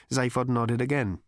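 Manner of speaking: ordinary talk, nothing marked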